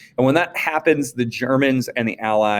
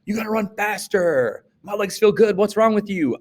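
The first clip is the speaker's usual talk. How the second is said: higher, pushy pep talk; pushy fresh runner; 10 semitones higher than usual. Retold or imitated. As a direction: egg on a tired runner, way up high. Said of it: "you're like".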